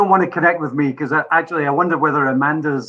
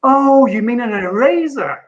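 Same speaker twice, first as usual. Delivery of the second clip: slow, high, louder "oh" of understanding; imitated relieved realisation, pitched up; dawning realisation; 9 semitones higher, 5 dB louder, slower than usual. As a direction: draw it out as the penny drops. Retells secretary's realisation.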